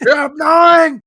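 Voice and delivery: shouted high and loud, furious; shouting at a girl on the bus; yelling at her. Retold or imitated. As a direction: blast it at the annoying girl. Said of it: "I was like".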